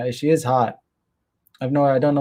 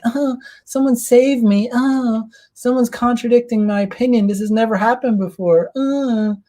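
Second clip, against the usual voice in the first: fake sweet damsel; 9 semitones higher than usual. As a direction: ham up a damsel in distress to mock her. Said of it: "pretending to be all sweet and feminine".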